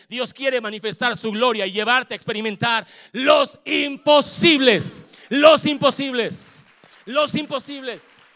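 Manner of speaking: loud, drawn-out preaching shout on imposibles. Real, not acted. Pastor shouting God's promise to the church. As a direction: roar the promise to the congregation.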